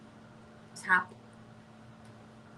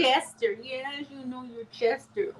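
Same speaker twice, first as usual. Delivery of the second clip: higher, slow cooing; cooing pet talk; 6 semitones higher than usual. Real, not acted. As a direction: coo to a little pet, stretching the words. Cooing to little pet Chester.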